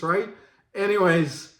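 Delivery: ordinary delivery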